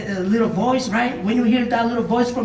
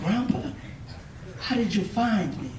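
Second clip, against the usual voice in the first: quiet, subdued disbelief; acted shock, hushed and trailing softer; understated shock; 8 dB quieter than usual. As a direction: ask it softly, mildly stunned. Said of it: "This young man was shocked".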